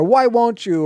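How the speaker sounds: high-pitched accusing protest; exclaimed, indignant challenge; stating why. Shown as a demonstration. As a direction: throw it out as a complaint. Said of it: "if I'm stating why".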